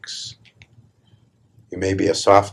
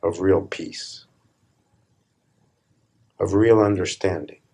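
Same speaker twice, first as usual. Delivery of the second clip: calm. Real, not acted. Slow, soft opening reflection.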